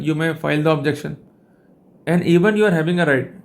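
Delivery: ordinary delivery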